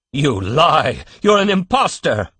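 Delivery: angry accusation. Acted. Father shouting at the old man in the crib, furious. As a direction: hurl the accusation at him.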